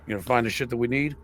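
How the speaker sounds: usual speaking voice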